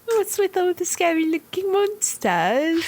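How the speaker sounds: put-on high posh little British child voice; mock posh British child. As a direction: play it as a prim little British kid. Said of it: "posh little British child".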